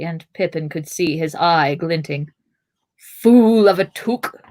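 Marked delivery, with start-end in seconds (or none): 3.0-4.4 slow, loud, higher scolding growl; drawn-out angry rebuke. A narrator's reading. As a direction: growl it slowly at a fool. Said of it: "he growled".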